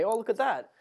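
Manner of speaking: high, put-on innocent amazement; mock surprise. Faking surprise at the sea level. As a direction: gasp at it like a naive beachgoer.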